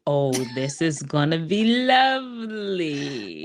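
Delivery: slow, sing-song teasing; drawn-out teasing. Real, not acted. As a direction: stretch it out, playfully mocking. Host teasing nervous guest.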